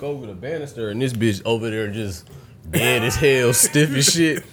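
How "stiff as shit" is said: ordinary delivery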